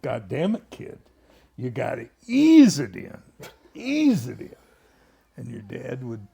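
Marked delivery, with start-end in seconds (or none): 0.0-4.6 mock impatient operator; imitating George. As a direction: scold the kid to ease it in. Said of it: "and George goes".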